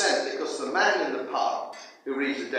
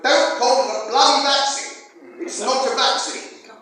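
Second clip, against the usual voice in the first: higher and louder, furious and emphatic; angry outburst; 9 semitones higher, 9 dB louder than usual. Real, not acted. Speaker to rally crowd, rejecting the word, angry shout. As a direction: spit out bloody vaccine in outrage. Ranting against the word vaccine.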